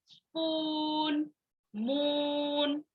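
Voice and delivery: स्पून, मून with the oo held long and level; long sounds. Shown as a demonstration. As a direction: stretch the oo in स्पून and मून slowly. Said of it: "ऐसे हम long sounds बोलते हैं". (स्पून, मून में ऊ को लंबा खींचना)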